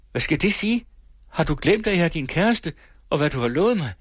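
high, pressing outburst that keeps climbing; young woman's fierce, high-pitched reproach; wild vehemence. Acted. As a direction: confront him, demanding answers. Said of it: "udbrød med vild heftighed".

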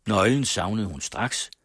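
ordinary delivery

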